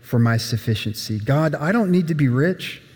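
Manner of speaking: prayer. Acted. Quoted prayer to God.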